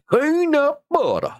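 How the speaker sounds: a long, very high mock squeal; playful squeal at the viewers, very high and drawn out. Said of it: "I'm gonna wake up y'all's neighbors".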